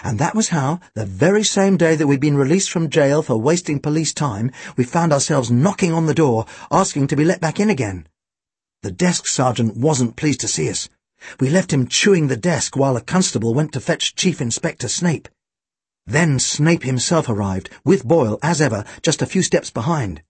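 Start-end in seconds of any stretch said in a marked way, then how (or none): none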